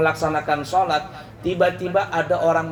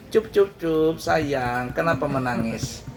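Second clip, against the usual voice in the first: put-on soothing a child; 3 semitones lower than usual. Prophet soothing the weeping palm stalk like a child. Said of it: "dibisai pelepah".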